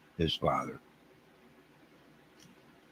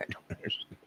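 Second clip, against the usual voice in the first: whisper; 15 dB quieter than usual. Real, not acted. Said twice, first whispered.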